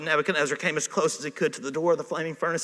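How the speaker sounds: usual speaking voice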